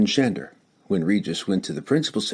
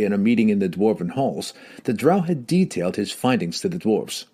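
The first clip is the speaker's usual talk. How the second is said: ordinary delivery, nothing marked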